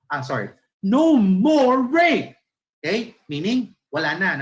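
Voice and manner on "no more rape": high, loud, drawn-out chant; rally protest. Shown as a demonstration. Teacher demonstrates a rally against abuse, loud protest. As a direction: call it out firmly like a protester. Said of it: "nagrarally ka na, wala na".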